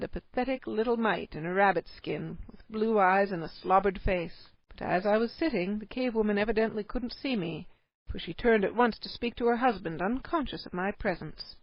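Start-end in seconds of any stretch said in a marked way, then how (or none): none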